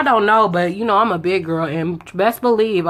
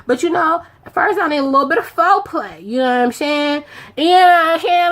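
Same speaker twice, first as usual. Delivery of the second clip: much higher, teasing voice, lightly put on; playful flirty self-quote; 7 semitones higher than usual. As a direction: coyly hold him off, pitched up. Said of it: "But I'm like".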